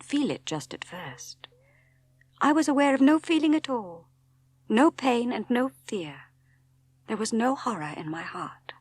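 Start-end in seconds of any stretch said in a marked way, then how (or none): none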